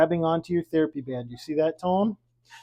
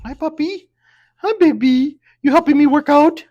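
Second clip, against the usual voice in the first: high doting pet voice, louder as it goes; much higher squeaky puppy talk; 9 semitones higher, 8 dB louder than usual. Real, not acted. Greeting the dog during class.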